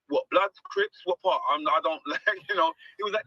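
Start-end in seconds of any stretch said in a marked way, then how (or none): 0.1-1.4 mock alarmed. Retells his alarmed reply to LA.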